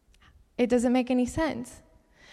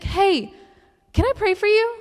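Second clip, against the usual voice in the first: put-on much higher, louder bright call of an eager girl; 10 semitones higher, 6 dB louder than usual. Girl at the base offering to pray, eager high call. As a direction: chirp it out like an eager young stranger. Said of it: "She's like".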